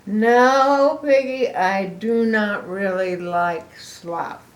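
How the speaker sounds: loud "No", then slow; honest elephant. Acted. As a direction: admit it gently. Gerald honest.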